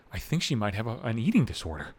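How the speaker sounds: soft, low imitation of a worried friend; hushed, low retold worry; hesitant confiding. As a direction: confide it gently, haltingly. Voicing the worried friend's confession.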